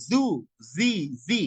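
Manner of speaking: "জু, জি" with buzzing z: zoo, zee; z not j. Shown as a demonstration. Teacher drills the z sound, "জু, জি" as zoo, zee. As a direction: buzz each of "জু, জি" as z. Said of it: "জ না কিন্তু জু".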